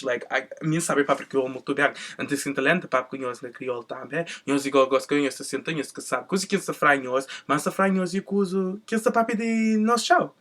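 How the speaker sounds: switching into Cape Verdean Creole; speaking Creole. Shown as a demonstration. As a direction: rattle off the Creole casually. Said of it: "I can speak Creole".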